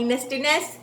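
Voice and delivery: normal talking voice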